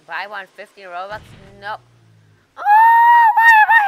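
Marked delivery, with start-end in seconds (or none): 2.6-3.8 shocked shout. Real, not acted. Shocked at being jailed.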